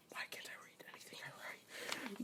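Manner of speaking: very soft whisper to oneself; hushed muttering whisper; whispered aside. Real, not acted. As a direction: whisper it as a self-critical aside. Whispered self-mocking aside.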